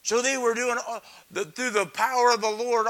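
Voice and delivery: ordinary talk, nothing marked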